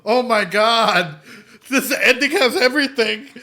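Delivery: loud, high, half-laughing half-sobbing; overcome, laughing through real tears; laughing and crying. Real, not acted. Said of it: "I'm getting all fucking emotional".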